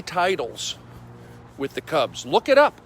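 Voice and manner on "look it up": emphatic command. Real, not acted. Insisting friend's record is real.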